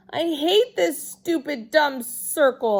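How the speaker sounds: loud, high angry holler; childish hollered complaint. A narrator's reading. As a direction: yell it out in a temper. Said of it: "I hollered".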